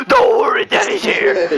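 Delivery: high, loud mock shouted comfort; Big Daddy Yay screaming. Revealing who's screaming in the song, mock shout. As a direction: scream the reassurance down the house. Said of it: "who's screaming me house down".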